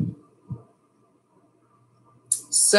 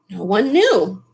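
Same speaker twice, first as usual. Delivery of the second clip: usual speaking voice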